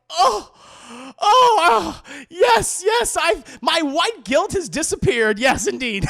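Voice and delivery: overacted sobbing gratitude; hammed-up tearful joy. Coach Cotton hugged by Big Mike, hammed-up tearful high voice.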